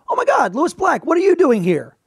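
high, excited gush of a desk clerk recognizing a star; starstruck desk clerk. Retold or imitated. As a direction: squeal it like a fan meeting a celebrity. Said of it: "she looks up and goes".

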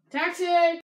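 loud high hail for a cab; calling a taxi. Acted. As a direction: shout across the street. Hailing a cab.

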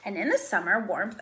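ordinary delivery

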